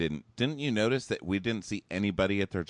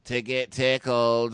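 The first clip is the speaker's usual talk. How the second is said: slow, louder, higher creep voice; creepy punchline; 4 semitones higher, 6 dB louder, slower than usual. Retold or imitated. Creepy tickle monster telling Rachel a joke, mock-creepy punchline. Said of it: "This guy was so creepy".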